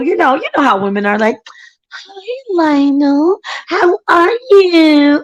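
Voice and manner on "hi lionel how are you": slow, higher, syrupy flirt; mock a woman cooing sweetly at a man; sweet talk. Imitating how women sweet-talk her husband, slow high coo. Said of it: "you know that sweet talk".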